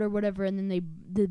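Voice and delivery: ordinary delivery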